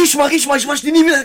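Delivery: high excited boast; excited loud. Retold or imitated. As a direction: shove the hand forward, thrilled. Quoting the excited guy.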